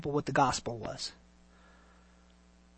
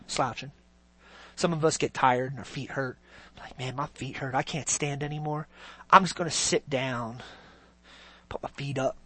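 much softer, slouching tired mumble; mock lazy believer; 6 semitones lower, 8 dB quieter than usual. Preacher imitates a tired believer, soft and slouching.

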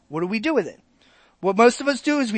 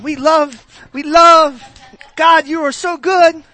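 louder, higher rapturous praise; mock worship gush; 6 semitones higher, 8 dB louder, slower than usual. Pastor imitating worshippers, louder rapturous gush. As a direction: swoon through the praise.

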